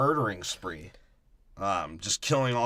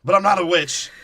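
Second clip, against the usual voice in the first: lightly voiced pirate accent on "I'm not a witch"; 6 semitones higher, 6 dB louder than usual. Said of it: "Why am I talking like a pirate?".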